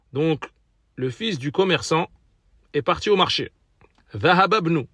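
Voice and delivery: ordinary talk, nothing marked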